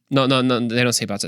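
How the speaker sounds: usual speaking voice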